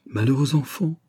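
mother close to crying over her son; sobbing voice. A narrator's reading. Mother sees her soaked son, tearful. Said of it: "avec des sanglots dans la voix".

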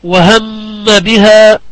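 slow, humming on the doubled م of وهم; tajweed ghunnah recitation. Shown as a demonstration. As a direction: hold the مّ in وهم nasally, then release into بها. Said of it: "الميم المشددة من كلمة".

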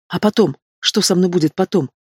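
ordinary delivery

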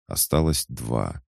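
usual speaking voice

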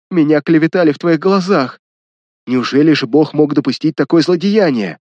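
ordinary delivery, nothing marked

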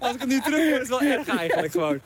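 talking through real laughter; embarrassed laugh running through the words. Real, not acted. Friend hearing an old remark of their own, laughing.

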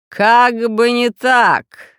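very drawn-out, loud villain call; loud booming interjection. A narrator's reading. Hidden villain interrupts from above, loud and drawn out. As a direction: stretch each word menacingly from on high. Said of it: "прозвучал громкий голос".

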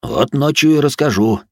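teasing smirk. A narrator's reading. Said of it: "хмыкнул солдат".

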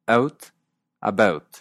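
"out, about" with a neutral vowel; central Canadian vowel. Shown as a demonstration. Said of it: "se oye una vocal más neutra". (vocal neutra en "out, about")

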